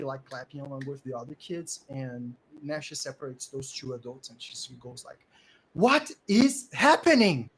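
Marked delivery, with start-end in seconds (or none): 5.7-7.5 loud, high, slow exclamation; each word loudly spaced out; shocked demand. Acted. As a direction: break up a fight with it. Acted woman separating two fighting men.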